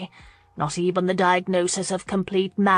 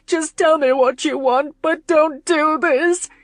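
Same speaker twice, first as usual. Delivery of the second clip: much higher and louder, frantic, slowing on the last words; wailing in panic, begging him not to go; hysterical crying plea; 8 semitones higher, 5 dB louder than usual. A narrator's reading. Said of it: "she cried hysterically".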